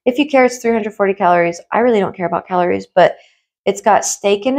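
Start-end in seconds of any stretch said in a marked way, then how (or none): none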